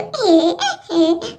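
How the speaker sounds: short low giggle thrown back; mocking giggle. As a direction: laugh right back at her.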